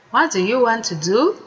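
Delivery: usual speaking voice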